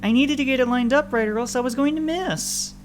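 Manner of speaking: put-on high, loud child's voice; defensive protest. Child explaining the missed shot to father, defensive. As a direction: plead your case, pitched right up.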